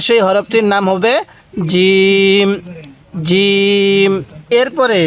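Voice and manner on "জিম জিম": hard, firm j in জিম, vowel held long; firm pronunciation. Shown as a demonstration. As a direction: press the j of জিম, then sustain it evenly. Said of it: "জিমটা শক্তভাবে উচ্চারণ করব".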